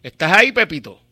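loud call to the caller. Real, not acted. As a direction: shout to check he's there. Host calling out to caller.

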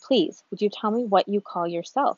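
plain reading voice

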